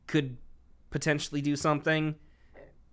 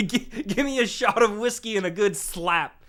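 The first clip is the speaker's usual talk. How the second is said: laughing; 7 semitones higher than usual. Acted. Playing tough 1940s New York fixer.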